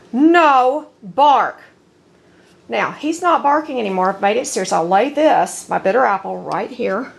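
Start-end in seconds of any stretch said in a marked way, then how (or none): 0.1-1.6 slow, louder, firm command; stern command tone. Shown as a demonstration. Trainer stopping her dog's barking, slow firm louder command. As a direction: drop a stern warning on the dog. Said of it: "That's my tone of voice for that".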